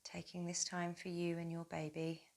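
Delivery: soft, low-key welcoming murmur; guided meditation. Acted. Yoga teacher welcoming mothers to themselves, soft guided meditation. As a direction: welcome them into stillness, very softly.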